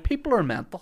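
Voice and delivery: high-pitched disbelief; incredulous. Retold or imitated. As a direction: exclaim it in shock. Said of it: "and I'm like".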